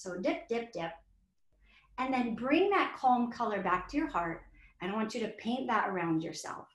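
put-on gentle guiding voice for a kids' yoga class; guided calming. Shown as a demonstration. Guides children through painting calm.

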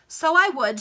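high, loud burst; sudden high outburst from the foppish captain; excited exclamation. Acted. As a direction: blurt it out eagerly.

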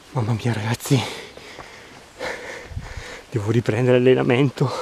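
winded, puffing through the words; breathless after the uphill walk; out of breath. Real, not acted. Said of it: "scusate per il fiatone e la voce".

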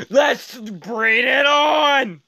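long drawn-out battle cry; slow, rising rally cry; pumped-up challenge. Real, not acted. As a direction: stretch it out to hype the race. Hyping up the race.

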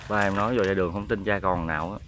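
usual speaking voice

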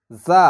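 জা with a hissing, whistled z, loud. Shown as a demonstration. Teacher showing the letter za, whistled pronunciation. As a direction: sound জা as a sibilant z. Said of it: "এটা শীষ দিয়ে উচ্চারণ হবে".

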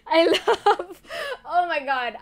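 talking through giggles; laughing. Real, not acted. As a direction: try to restart while cracking up. Laughing at own fumbled words.

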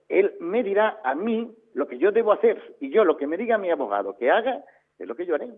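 ordinary talk

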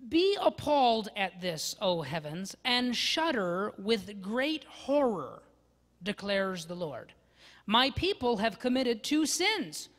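loud, slow solemn reading; solemn scripture reading. Acted. Pastor reading Jeremiah aloud to the congregation, solemnly. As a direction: proclaim it with gravity.

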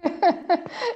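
amused burst of laughter, high-pitched; real laugh. Real, not acted. Panelist amused by the moderator's good question, laughing. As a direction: laugh out loud, unforced. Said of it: "I'm laughing".

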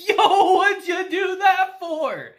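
aghast at what his friend just did; shocked question. Retold or imitated. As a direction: ask it in disbelief. Takafumi shocked barrier destroyed.

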